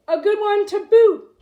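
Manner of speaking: loud, high rowdy chorus; pirate crew shout. A narrator's reading. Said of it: "the others agreed".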